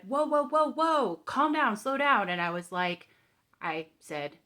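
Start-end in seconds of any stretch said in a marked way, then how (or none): 0.0-2.3 high, loud whoa, whoa, whoa; calm down plea. Retold or imitated. Telling the panicked family member to calm down, loud and high. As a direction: push back loudly to stop the rush.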